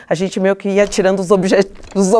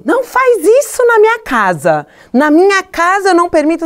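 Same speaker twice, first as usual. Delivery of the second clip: high-pitched mock scolding of a child; scolding parent; 11 semitones higher, 3 dB louder than usual. Daughter imitating mother forbidding things at home, high mock scolding. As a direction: lay down the house rules, imitating an angry parent.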